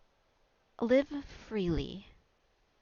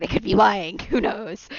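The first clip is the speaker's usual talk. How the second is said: loud, playful ominous warning; loud teasing; 13 dB louder than usual. Acted. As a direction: boom it out teasingly. GM repeats for effect.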